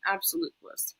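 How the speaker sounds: breathy whisper of awe. Shown as a demonstration. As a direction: whisper it like a secret. Repeating the phrase for effect.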